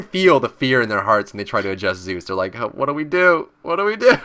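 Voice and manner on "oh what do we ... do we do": rising, higher panicky mock whimper. Gamer mimics panicked enemies facing Zeus, mock fear. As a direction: play the frightened enemies scrambling. Mocking scared enemy players.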